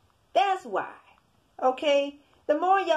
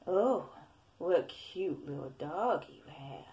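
low, soft and slow seductive devil voice; seducing Satan voice; 4 semitones lower, 8 dB quieter, slower than usual. Retold or imitated. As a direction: coax it out like a tempting demon, lower, softer, slower. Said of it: "this how he sound".

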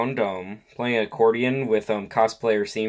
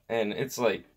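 ordinary talk, nothing marked

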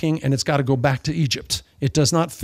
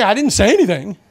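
mock innocent denial; 4 semitones higher, 4 dB louder than usual. Mocker denies, preacher retorts.

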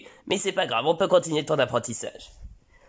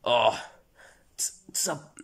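mock remorseful father; 8 dB louder than usual. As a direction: apologise like the worst father alive. Father repents.

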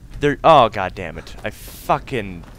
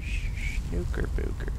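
whispered; 9 semitones higher, 5 dB quieter than usual. Acted. Silly rhyme muttered at a game.

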